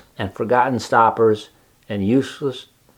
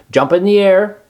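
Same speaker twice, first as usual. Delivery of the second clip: higher, bright command; crying out; 8 semitones higher, 4 dB louder than usual. Acted. Charlotte ordering Wilbur, crying out. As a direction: call the order out. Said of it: "cried Charlotte".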